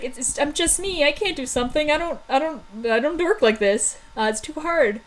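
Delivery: high, faltering mock distress; near tears, acted. Thunder can't make her fire work, acted near-tears stammer. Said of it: "shaking on the verge of tears".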